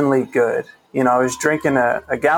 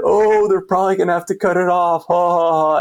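joking; 5 semitones higher, 3 dB louder than usual. Retold or imitated. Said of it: "we start cracking jokes".

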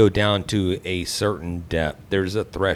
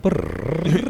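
engine noise; 10 semitones higher than usual. Retold or imitated. Imitating the car starting.

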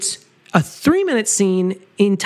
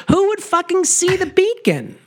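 incredulous; 11 semitones higher than usual. Retold or imitated. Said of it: "and I'm like".

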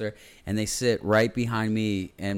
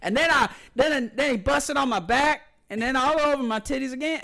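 high, loud crude bragging voice; imitating women on the train talking crudely; mocking hooker voice; 3 dB louder than usual. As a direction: ham up a crude woman bragging. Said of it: "they were just like".

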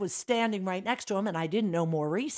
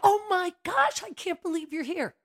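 high, loud starstruck gasp; retold excited gush; 10 semitones higher, 4 dB louder than usual. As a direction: gush like a starstruck fan meeting him. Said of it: "I was like".

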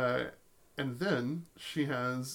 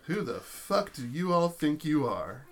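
loud, higher-pitched indignant challenge; angry confrontation; 4 semitones higher, 7 dB louder than usual. Acted. Woman starting a club brawl, angry confrontation. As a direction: call them out, loud and affronted.

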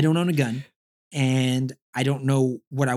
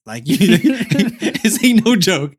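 laughing; 4 semitones higher, 6 dB louder, much slower than usual. Retold or imitated. Laughs while warning wife.

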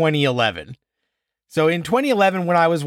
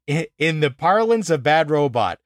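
normal talking voice

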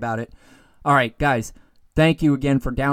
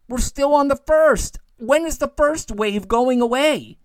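exasperated outburst; 8 semitones higher, 4 dB louder than usual. Real, not acted. Exasperated rant about the virus wave.

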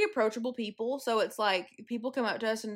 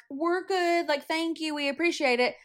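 polite brush-off; 6 semitones higher than usual. Retold or imitated. Politely refusing pushy strangers.

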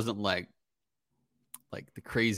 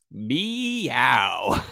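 drawn-out playful "meow"; joking meow; 4 dB louder than usual. Retold or imitated. Phrase said to Heather as a joke, playful meow. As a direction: tease with a long two-part meow. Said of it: "in a very joking way".

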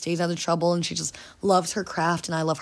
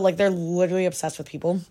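ordinary talk, nothing marked

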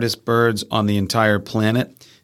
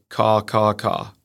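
stuffy-nosed fish crow; slower than usual. Shown as a demonstration. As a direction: crow caws through a plugged nose. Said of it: "sounds like its nose is plugged".

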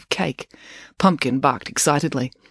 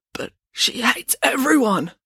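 higher, slower, forced through a cough; choked out; 9 semitones higher, slower than usual. Acted. Finn, coughing, answers Loretta in a choked voice. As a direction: squeeze the words past a choking cough. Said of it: "He just managed to choke out the words".

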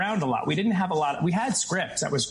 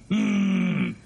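throat buzz demo; 4 dB louder than usual. Shown as a demonstration. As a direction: hum a low gravelly buzz like clearing the throat. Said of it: "It's like, mm".

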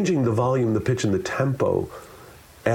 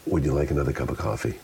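low, quick, understated question; subtly shifted tone; 6 semitones lower, faster than usual. Shown as a demonstration. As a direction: ask it quickly in a lowered pitch. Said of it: "Each of those are subtle shifts".

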